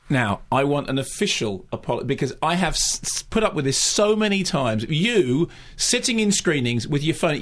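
emphatic scolding with hammered key words; aggressive, indignant telling-off; bullying, hectoring rant. Real, not acted. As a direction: lay into a colleague, demanding an apology. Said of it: "very bullying, bullying, hectoring style".

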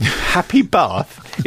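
slow, derisive imitation; mocking echo. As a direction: parrot it back slowly, in ridicule. Mocks co-host's feeble sign-off.

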